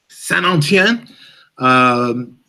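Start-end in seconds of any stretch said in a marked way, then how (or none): 0.1-1.1 Saint-Étienne said slowly in a French accent, nasal vowels; Saint-Étienne drawn out the French way, higher. Shown as a demonstration. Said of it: "you'd think I couldn't do a French accent anymore".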